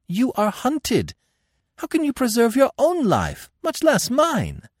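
very high, urgent protest; alarmed queen arguing with her captor. A narrator's reading. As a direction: protest at high pitch, stretching the words. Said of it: "she protested".

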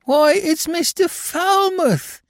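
high, drawn-out astonishment; startled recognition in a high character voice; astonished. A narrator's reading. Prisoner recognizes the detective, astonished. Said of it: "said the astonished Billy".

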